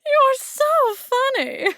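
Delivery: high, loud and slow gush; drawn-out delighted squeal. Real, not acted. To the newcomer, amused and gushing, high and loud.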